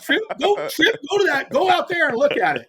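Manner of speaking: high, loud, hammed-up commands; mock boss ordering. Official sending his assistant to the bridge, hammed-up ordering. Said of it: "have my personal assistant".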